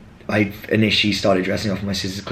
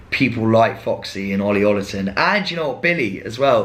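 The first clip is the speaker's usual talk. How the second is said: ordinary delivery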